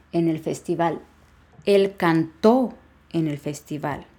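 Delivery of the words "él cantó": "cantó" drawn out on the last syllable; slow demo, "cantó" with stressed final ó. Shown as a demonstration. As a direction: lean slowly on the -tó of "cantó". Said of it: "va a llevar acento en la O".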